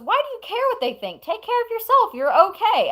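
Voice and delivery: reassuring, lightly put on; man comforting her. A stranger reassures her crying, in a high mock voice.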